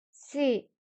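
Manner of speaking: ordinary delivery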